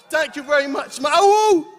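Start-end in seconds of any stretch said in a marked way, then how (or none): 1.1-1.7 high loud "awu" rally cry; hype call. Real, not acted. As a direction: throw a high whooping call to the crowd. Rally cheer to crowd.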